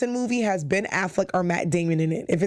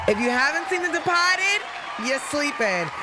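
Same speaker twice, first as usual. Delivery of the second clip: excited hype; 8 semitones higher than usual. Real, not acted. Vlogger urging viewers to watch, excited. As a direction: sell it hard to the viewers.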